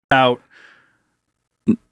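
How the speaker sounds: normal talking voice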